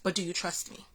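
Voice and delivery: a soft, hushed voice of God asking "trust me"; God's voice, hushed. Retold or imitated. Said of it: "I heard God say".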